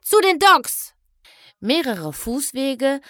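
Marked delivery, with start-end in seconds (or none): 0.0-0.9 high, loud urgent cry; shouting. A narrator's reading. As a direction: yell the way forward. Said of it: "schrie Twilight Sparkle".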